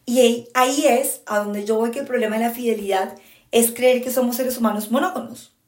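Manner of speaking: ordinary talk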